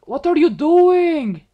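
slow, high, loud disbelief; incredulous. Real, not acted. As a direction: stretch it out in shock. Sudden reaction to partner's action.

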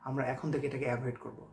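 ordinary talk, nothing marked

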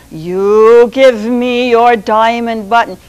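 slow, loud order; stern Sultan. Acted. Sultan ordering the rooster to hand it over, slow and loud. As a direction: Sultan insists, weighing each word. Sultan repeats his demand.

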